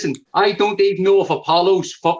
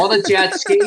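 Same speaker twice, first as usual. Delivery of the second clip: much higher, rising disbelief; mock astonished high echo; incredulous echo; 12 semitones higher than usual. Real, not acted. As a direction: repeat it like you can't believe it.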